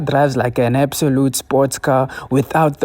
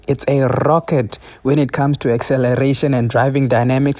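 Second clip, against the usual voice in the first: ordinary talk, nothing marked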